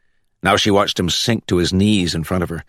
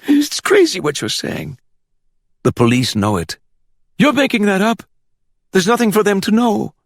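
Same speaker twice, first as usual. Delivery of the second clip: much higher, rattled protest; anxious denial. A narrator's reading. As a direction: brush it off in a frightened rush. Hector panics, denies the plot.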